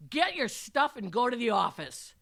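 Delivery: thundering dismissal of a student; bellowed order. A narrator's reading. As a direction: bellow the command across the classroom. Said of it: "I bellowed".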